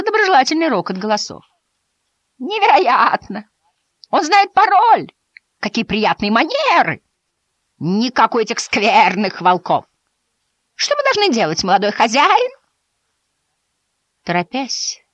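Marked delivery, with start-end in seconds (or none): 2.4-12.5 excited sheep voices. Acted. The sheep answer Babe.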